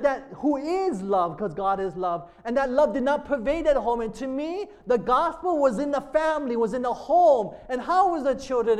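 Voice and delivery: driving, emphatic sermon delivery; impassioned preaching. Real, not acted. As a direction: preach it with force, hammering the key words. Preaching at full force.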